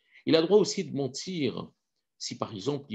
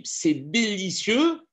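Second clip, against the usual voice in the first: slow, drawn-out delicious; savoring praise; 9 semitones higher, much slower than usual. Retold or imitated. Guest praising the meal, slow and savoring. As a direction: relish the word délicieux slowly. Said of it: "s'il dit".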